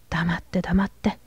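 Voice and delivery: whispered, scolding hush. A narrator's reading. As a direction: hush her sharply under the breath. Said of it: "小さな声で叱るように言いました". (ヘアトンがキャサリンを小声で叱る)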